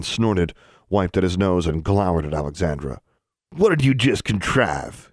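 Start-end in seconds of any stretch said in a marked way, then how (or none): 3.6-5.1 slow, higher-pitched menace; through gritted teeth. A narrator's reading. Ozarker boy towers over Alexandra, slow angry demand. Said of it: "gritting his teeth".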